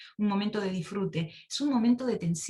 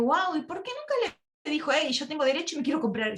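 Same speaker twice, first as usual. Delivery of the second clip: amazed urging; 6 semitones higher than usual. Retold or imitated. Urging the widow to speak up.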